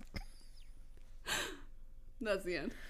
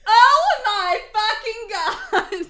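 scream; 11 semitones higher, 13 dB louder than usual. Acted. Goth girl thrilled by concert news, screaming. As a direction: scream it at full volume, laughing. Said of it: "she screamed again".

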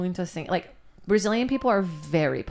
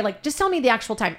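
ordinary talk, nothing marked

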